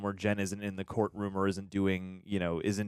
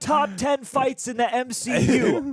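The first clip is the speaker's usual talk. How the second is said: Coy impression; 14 semitones higher, 7 dB louder than usual. Co-host parodying Coy ranking MCU fights, hammed-up impression. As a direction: boom it out as a mock top-ten list. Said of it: "Were you trying to do your Coy impression?".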